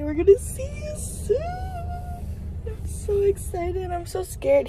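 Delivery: bubbling over with nerves; excited. Real, not acted. Excited message to the unborn baby.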